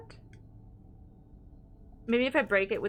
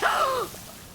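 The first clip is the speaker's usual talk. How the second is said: sudden high gasp; real high-pitched startle; startled gasp. Real, not acted. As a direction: gasp sharply in fright. Startled again while shooting.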